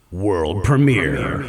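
slow, low, drawn-out announcer voice. Pretend. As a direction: boom it out like a movie trailer. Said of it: "I want to world premiere something".

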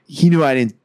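normal talking voice

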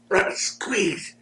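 slow, softened, high urging; drawn-out squeeze, high and held back; mock urgent plea. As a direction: imitate a covert strained plea. Retold wrestler urging during secret blading.

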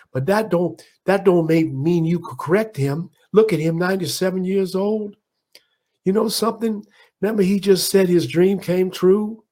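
high, preaching champ lecturing with conviction; Ali impression. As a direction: lecture them high and passionate on respect. Ali lectures entourage on dignity.

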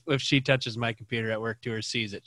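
usual speaking voice

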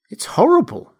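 high, appalled exclamation; shocked recoil in the voice; horrified. A narrator's reading. Kemp is appalled.

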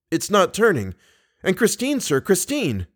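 high, urgent plea; anxious urging. Acted. Young man fears for Christine at the stuck mirror, urgent. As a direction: plead with pitch leaping up on her name.

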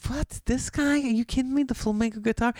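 can't believe she's with him; incredulous squeal. Retold or imitated. Jealous comic eyeing girl's date, squealing disbelief.

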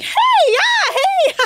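high, loud, laughing hey; jumpy over-the-top hello; startled mock greeting. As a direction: squeak it out like a startled deer. Mimicking Caroline's startled greeting.

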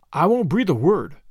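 usual speaking voice

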